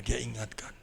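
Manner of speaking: ordinary delivery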